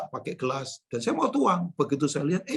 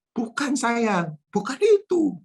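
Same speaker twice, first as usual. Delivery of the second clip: mock reassurance; 6 semitones higher, slower than usual. Father reassuring his teasing child.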